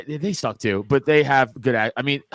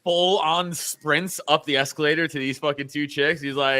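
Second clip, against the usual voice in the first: usual speaking voice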